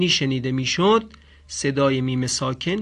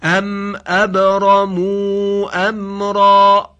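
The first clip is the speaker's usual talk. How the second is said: slow, loud chanted recitation, higher; Quran recitation; 4 semitones higher, 7 dB louder, much slower than usual. Shown as a demonstration. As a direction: intone it slowly and fully. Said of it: "به این عبارت گوش بدید". (تلاوت کشیده و بلند قرآن)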